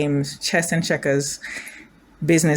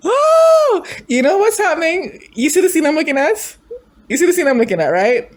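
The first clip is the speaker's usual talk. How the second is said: high, excited squeal; pitched way up and eager; giddy excitement; 9 semitones higher than usual. Real, not acted. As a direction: squeal it, thrilled by what you see. Giddy reaction to preview scene.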